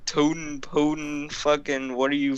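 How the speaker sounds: normal talking voice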